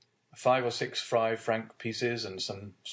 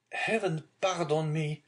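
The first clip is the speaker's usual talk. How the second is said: remorseful cry; 7 semitones higher than usual. A narrator's reading. Son kneeling before his father, mildly anguished cry. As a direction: beg pardon with understated anguish. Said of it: "cried Edmond".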